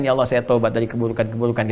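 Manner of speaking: understated pleading prayer; devout prayer. Acted. Recites prayer and translation.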